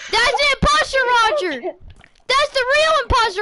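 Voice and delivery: high-pitched mock alarm; playful, high-voiced pointing out; urgent accusation. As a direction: raise the alarm on an impostor. Calling out the imposter in play.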